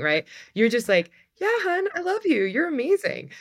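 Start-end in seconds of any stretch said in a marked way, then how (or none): 1.4-3.3 sweet parent praise. Pretend. Parent gushing over perfect daughter.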